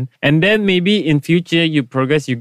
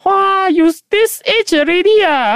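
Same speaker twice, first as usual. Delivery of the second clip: singlish auntie. Retold or imitated. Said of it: "They will say like".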